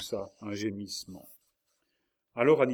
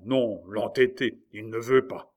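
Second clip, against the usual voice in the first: howled frustration; 8 dB louder than usual. A narrator's reading. King raging to his mother about Henri, frustrated shout. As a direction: hammer out each word angrily. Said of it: "hurla Charles".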